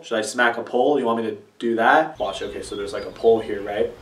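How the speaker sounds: ordinary talk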